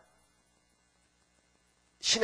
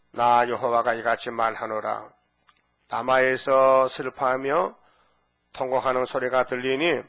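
scripture reading; 3 dB louder than usual. Acted. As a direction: read it like holy words. Reads scripture in preaching voice. (엄숙한 성경 낭독)

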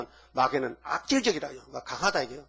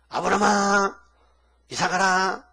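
higher, louder mock pulpit proclamation; imitating a preacher; 4 semitones higher, 6 dB louder than usual. Mocking a TV pastor's dramatic sermon to his own congregation, loud and high. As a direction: ham up another pastor's booming sermon. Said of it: "하는데 이렇게 하더라고요".

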